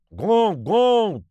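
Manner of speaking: loud high rallying cry; gander's shout. A narrator's reading. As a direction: goose calling the alarm. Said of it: "закричал гусак".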